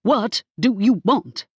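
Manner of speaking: frazzled stylist screeching her demand. Acted. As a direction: shriek the question at her. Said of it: "Ida screeched".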